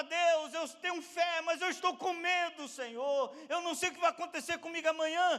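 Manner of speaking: high, loud, urgent plea; shouted prayer. Acted. Believer confessing fear to God, crying out. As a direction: cry out the confession at full force. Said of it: "Gritar é falar".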